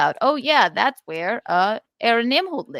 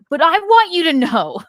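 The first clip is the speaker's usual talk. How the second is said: high, loud mock proclamation; imitated outburst, pitched way up; retold emphatic declaration; 9 semitones higher, 9 dB louder than usual. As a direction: blurt it out loudly as his comic interjection. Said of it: "He's like".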